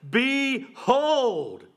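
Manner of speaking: "Behold" called out with a long falling ring; emphatic cry. Shown as a demonstration. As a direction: announce "Behold" like a herald. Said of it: "And it's emphatic".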